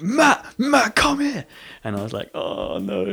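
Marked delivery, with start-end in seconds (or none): 0.0-1.5 high, loud call across the playground; bully shouting after him in the playground, loud high call. Retold or imitated. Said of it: "come out and shout". 2.3-3.0 high, mock-dreading oh no; a small comic gulp of dread. Dread at bully's call.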